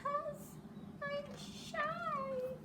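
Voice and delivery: very high and soft, small and timid; trembling shy voice. Acted. The shy one answering from the hole, tiny and timid. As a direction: squeak it out shyly. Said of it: "a trembling voice says".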